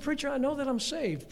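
a hint of a confident churchgoer; imitating a congregant. Preacher voicing a listener answering him, lightly mimicked. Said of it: "You said".

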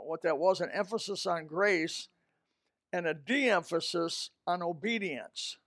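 ordinary talk

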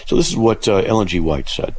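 ordinary talk

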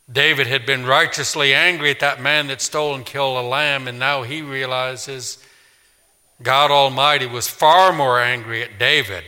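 ordinary talk

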